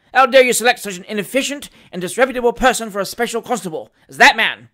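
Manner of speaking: loud, high, fast tirade; furious magistrate building to a shout; passionate rage. A narrator's reading. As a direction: berate the constable, outraged. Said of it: "reddening with passion".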